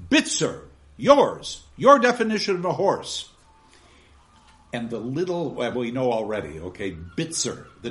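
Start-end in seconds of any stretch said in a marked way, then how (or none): 0.1-3.3 stern call. Acted. Gradgrind calls on Bitzer.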